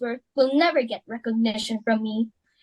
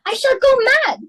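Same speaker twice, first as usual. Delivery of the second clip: exasperated outburst; 11 semitones higher, 6 dB louder than usual. Acted. Hostess frantic over dinner seating.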